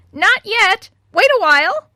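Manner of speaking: very high, loud squawked reply; parrot shriek. A narrator's reading. Parrot answers the old woman's call, shrieking.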